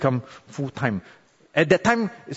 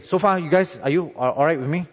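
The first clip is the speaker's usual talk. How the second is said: usual speaking voice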